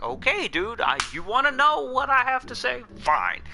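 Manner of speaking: high-pitched exasperated comeback; exasperated retort. Retold or imitated. Retelling own exasperated comeback.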